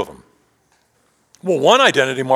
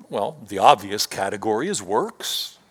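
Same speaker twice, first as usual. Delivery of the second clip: usual speaking voice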